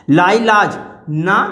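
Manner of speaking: ordinary talk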